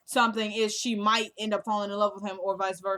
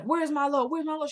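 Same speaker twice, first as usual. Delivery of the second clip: higher, pushing, mock menacing demand; demanding threat; 5 semitones higher than usual. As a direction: lean in and demand it, imitating a thug. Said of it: "he's like".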